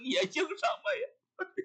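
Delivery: high, slow wail with a sob; mock crying. Priest wails over his ruined mirror, hammed-up sobbing. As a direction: blubber it out like a spoiled child. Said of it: "这俩都哭了".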